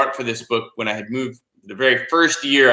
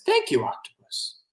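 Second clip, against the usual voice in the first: put-on soft little-boy voice trailing away; grateful boy; 2 semitones higher, 6 dB quieter than usual. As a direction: give small, quiet thanks. Boy gratefully accepts help.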